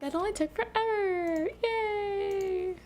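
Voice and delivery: high, sing-song mock celebration; sarcastic cheer. Sarcastic cheer after long search.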